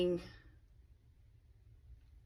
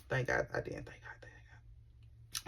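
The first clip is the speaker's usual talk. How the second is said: whispered thanks; 17 dB quieter than usual. Real, not acted. Telling viewers she didn't have cancer, whispered. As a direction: breathe it out in relief. Relief after cancer scare.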